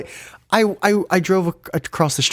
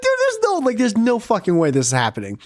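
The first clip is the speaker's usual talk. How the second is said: disbelief; 7 semitones higher than usual. Retold or imitated. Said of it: "I'm like".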